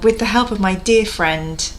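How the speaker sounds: ordinary talk, nothing marked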